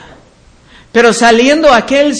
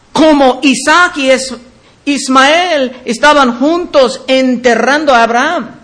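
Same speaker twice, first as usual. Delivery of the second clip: usual speaking voice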